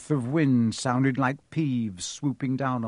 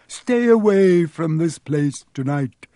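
loud, slow, raised croak; croaking command; 5 semitones higher, 8 dB louder, slower than usual. A narrator's reading. Harry as the Baron warning Peeves off, croaking command. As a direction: order it in a long, loud croak. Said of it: "croaked Harry".

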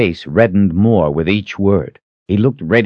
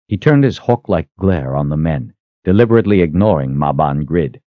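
usual speaking voice

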